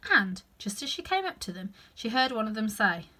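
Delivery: ordinary delivery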